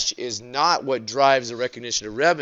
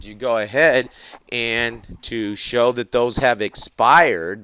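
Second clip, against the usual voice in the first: ordinary talk, nothing marked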